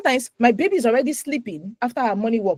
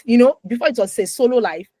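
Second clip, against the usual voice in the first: ordinary talk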